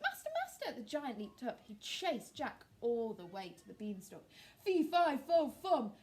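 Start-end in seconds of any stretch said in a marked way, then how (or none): 0.0-0.8 much higher shriek; high shrieking cry for its master; harp shrieking. A narrator's reading. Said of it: "but it shrieked". 4.6-5.9 loud, furious roar; angry giant roar. A narrator's reading. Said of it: "roared the angry giant".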